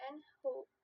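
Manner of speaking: ordinary delivery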